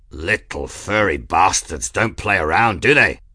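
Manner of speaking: loud, blurted burly crewman; burly aeronaut. A narrator's reading. Burly aeronaut remarks on the cats' fighting, impressed burly voice. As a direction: blurt it like a rough airship hand. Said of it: "blurted a burly aeronaut".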